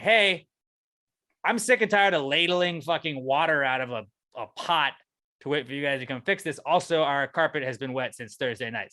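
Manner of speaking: angry complaint. Retold or imitated. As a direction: let them have it, you're sick of this. Angry call to maintenance.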